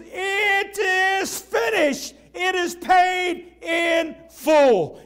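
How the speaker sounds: very slow, high, loud proclaiming shout; roar of Jesus. Acted. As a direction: bellow it out word by word in triumph. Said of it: "Listen to the roar of Jesus".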